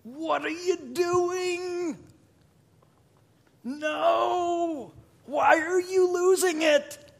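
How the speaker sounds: an apostle's pained outcry at his churches; Paul pleading. Acted. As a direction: cry out to the churches in dismay. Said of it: "he's saying".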